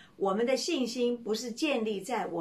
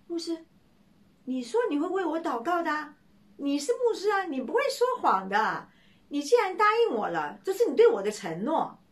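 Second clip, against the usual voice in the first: high, louder pleading insistence; a young man insisting the pastor cannot lie; insistent young man; 7 semitones higher, 5 dB louder than usual. Acted. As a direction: hold the pastor to his promise.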